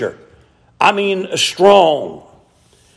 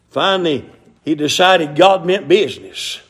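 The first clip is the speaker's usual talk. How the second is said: ordinary talk, nothing marked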